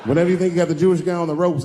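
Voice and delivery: ordinary delivery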